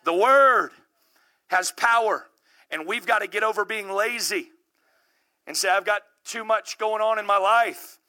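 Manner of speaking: normal talking voice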